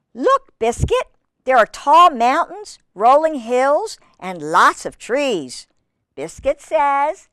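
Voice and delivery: measured, lilting storybook narration; reading to children. Acted. Reader telling children Biscuit's story, in a lilting read-aloud. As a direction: read it clearly to little listeners.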